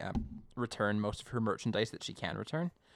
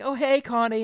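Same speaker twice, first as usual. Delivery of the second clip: higher, louder cheerful hello; cheery greeting; 12 semitones higher, 9 dB louder than usual. Retold or imitated. Simon greeting Connie, bright, higher and louder. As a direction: call out brightly to a friend. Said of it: "And he's like".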